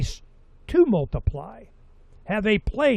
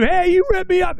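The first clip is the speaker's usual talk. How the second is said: much higher, whiny outraged cry; cheated investor; 13 semitones higher, 5 dB louder than usual. Acted. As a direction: squeal like a swindled customer.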